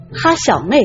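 ordinary delivery

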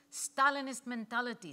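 first forceful take of the accusation; emphatic. Acted. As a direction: hammer the accusation out. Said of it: "Ludmila hissed".

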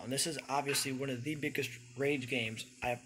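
ordinary delivery

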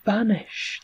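ordinary delivery, nothing marked

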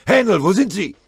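loud, high searching call. A narrator's reading. Sergeant calling into the woods for Hähnel, loud call. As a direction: shout into the trees.

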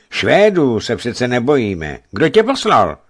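loud commanding rebuke; stern. Acted. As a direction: call down sternly and demand an answer. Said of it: "dodal přísně".

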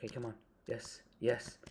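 normal talking voice

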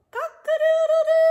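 very high, loud crowing; rooster crow. Acted. Reader voicing the rooster for children, loud crow.

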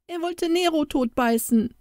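put-on high, upset child's voice; boy crying out. A narrator's reading. Jakob telling the pastor's wife what happened, upset high cry. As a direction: blurt it out like a distressed boy. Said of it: "rief Jakob".

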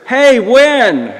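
high, loud friendly hail; calling out. Retold or imitated. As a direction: call to someone across a busy street. Driver calls out to passenger.

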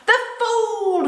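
high, loud witch shriek; high gloating witch cry; witch's cry. Acted. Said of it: "she cried".

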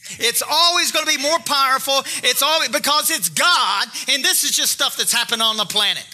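high, driving sermon delivery; full-force preaching. Real, not acted. Preacher urging the congregation to take courage, forceful. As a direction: preach it with rising conviction.

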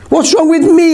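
very high-pitched, affronted echo; indignant retort. A narrator's reading. Narrator answers Norva's accusation, high indignant retort. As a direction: throw the blame back, pitched high.

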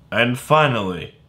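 ordinary talk, nothing marked

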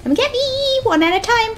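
high, lilting challenge; teasing high-pitched invitation; sing-song taunt. Real, not acted. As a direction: call them over in a high voice. Taunting enemies in game.

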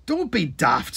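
lightly bellowed scolding. A narrator's reading. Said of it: "Sid bellowed".

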